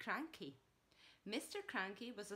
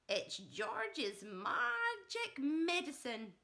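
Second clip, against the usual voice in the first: put-on high, slow, loud old-woman shout; gleeful old grandma shouting; 6 semitones higher, 6 dB louder, much slower than usual. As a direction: call it down from the roof, slowly. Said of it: "Grandma shouted".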